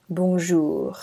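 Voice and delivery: "Bonjour" with a throaty French r. Shown as a demonstration. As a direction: say "Bonjour" like a native. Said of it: "our word is bonjour".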